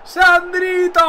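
high, drawn-out yell of the name; jubilant shout. Real, not acted. Player salutes Sandrito after the goal, jubilant shout. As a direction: hail the scorer at full voice.

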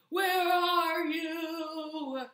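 high, loud, very slow call; crying out. A narrator's reading. Toad searches for Frog, long drawn-out cry. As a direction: call into the distance, stretching every word. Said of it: "cried the thing".

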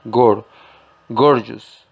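gor split off, then gorgeous with weight on gor; first syllable isolated. Shown as a demonstration. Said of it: "la fuerza ... en la primera sílaba". (separa gor y luego di gorgeous)

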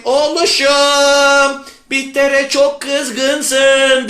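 very slow, stretched motherly delivery; empathetic mother. Acted. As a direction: draw out every word to comfort your son. Teacher re-enacts mother's empathy.

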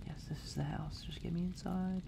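hushed nervous whisper. Real, not acted. Gamer whispering while sneaking home.

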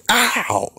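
a sharp, very high mock yelp of pain. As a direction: squeal "ow" like you just got hit hard. Mock pain cries in retelling.

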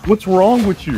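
high angry outburst at the screen; raging gamer. Real, not acted. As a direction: yell it in disbelief. Raging at a chasing enemy.

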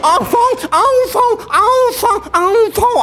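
put-on bait the old man with silly calls; taunting call. Taunting grandpa with nonsense calls. (ऊँची चिढ़ाती पुकार)

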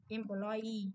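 "Employee" stressed on EE; weight the final syllable of "Employee"; stress on last syllable. Shown as a demonstration. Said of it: "rơi vào y nhá".

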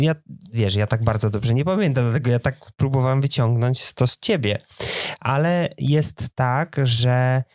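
usual speaking voice

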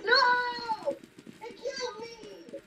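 being chased. Real, not acted. Chased and killed in game.

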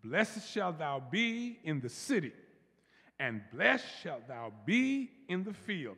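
slow, deliberate scripture reading; read slowly. Shown as a demonstration. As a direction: savour each word, nice and slow. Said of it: "We're gonna read it slowly".

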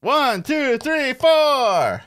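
excited count. Real, not acted. Counting spaces to her partner, gleeful and rising. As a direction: sing out the win.

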